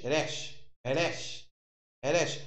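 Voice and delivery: "resh" repeated slowly, the r rolled softly at the front of the mouth; Hebrew letter resh. Shown as a demonstration. Teacher drilling his class on the Hebrew letter, patient pronunciation demo. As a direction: drill "resh" with a light trilled r, each on a rising tune. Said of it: "ele se pronuncia resh".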